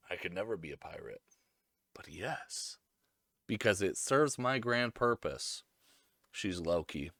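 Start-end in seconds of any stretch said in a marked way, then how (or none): 0.1-5.6 low, very soft retold admission; sly aside. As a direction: concede it quietly, as if scheming. Retold sly, reluctant agreement.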